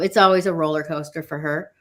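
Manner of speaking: ordinary talk, nothing marked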